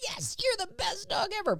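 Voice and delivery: high, bright praise to the dog; excited praise. Shown as a demonstration. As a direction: cheer the dog on, full of delight.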